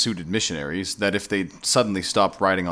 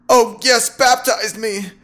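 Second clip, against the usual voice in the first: loud, high cry of delight; high, drawn-out rapturous yielding; ecstatic surrender; 14 semitones higher, 7 dB louder, slower than usual. A narrator's reading. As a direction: give in with an ecstatic shout. Man gives in ecstatically to seduction.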